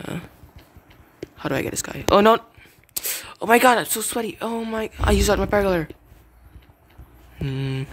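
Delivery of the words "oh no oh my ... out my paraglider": high, loud dismayed outburst; raised, rushed gamer panic; flustered panic. Real, not acted. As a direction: blurt it out as things go wrong. Panicking in the game.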